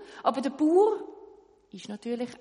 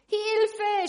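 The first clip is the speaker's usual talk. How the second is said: loud, high cry for help; scream for help; 12 semitones higher, 9 dB louder than usual. Acted. Tailor in the river, loud scream for help. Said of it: "schreit der Schneider".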